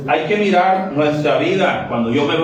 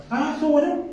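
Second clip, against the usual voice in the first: high, thin mock reply of a bored husband; 12 semitones higher, 3 dB quieter, much faster than usual. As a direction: toss it off like the unimpressed husband. Husband answers wife after service. (esposo aburrido con voz aguda y desganada)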